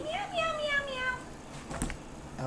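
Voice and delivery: very high, soft mewing; kitty meows. Acted. Teacher shows the kitty in the picture, soft high meows. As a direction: mew like a tiny kitten. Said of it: "I see a little kitty".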